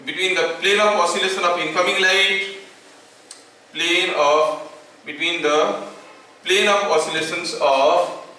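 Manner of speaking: word by word, very slow dictation with long pauses; teacher dictating a law for notes. Acted. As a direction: dictate to students taking notes.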